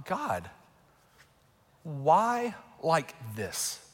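soft, drawn-out questioning prayer; subdued pleading. Retold or imitated. Father asking God about daughter's healing, subdued pleading. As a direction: ask God slowly, with long pauses.

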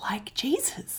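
hushed whisper. Real, not acted. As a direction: whisper it like a secret. Whispered joke about Jesus.

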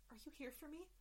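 a lightly voiced shy Kedian; timid alien. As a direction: ask it small and hopeful.

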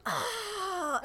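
sharp high cry of pain. Retold or imitated. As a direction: yelp as if hit by the car door. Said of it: "and I was like".

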